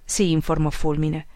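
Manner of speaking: ordinary delivery, nothing marked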